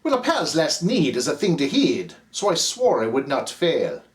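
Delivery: ordinary delivery, nothing marked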